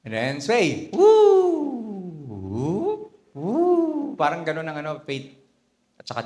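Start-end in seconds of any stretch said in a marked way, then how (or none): 1.0-4.2 playful hammock whoops. Swaying on a hammock.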